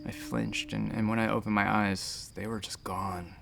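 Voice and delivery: very soft, low, near whisper; hushed, halting account of a horror; shaken recollection. Acted. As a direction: sink almost to a whisper, stunned. Recounting loved one's loss.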